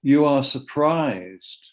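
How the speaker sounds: slow, measured poetry reading; poem reading. Acted. Poet reads the poem for his son turning forty, measured poetry reading. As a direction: give each phrase room, firm onsets.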